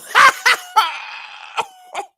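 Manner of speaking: shrieking laughter; loud high cackle. Real, not acted. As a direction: let out a big high shriek. Big laugh at name.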